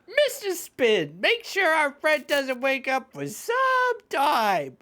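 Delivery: high, slow villain voice. Acted. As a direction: give orders like a smug goon. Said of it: "voice these characters".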